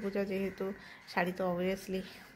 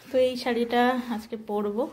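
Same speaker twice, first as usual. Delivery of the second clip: usual speaking voice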